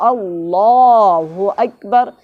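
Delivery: chanted teaching takbir; slow takbir. Shown as a demonstration. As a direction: recite اللہ اکبر slowly for the class. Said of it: "فوراً بولتے ہیں اللہ".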